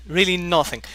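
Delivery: usual speaking voice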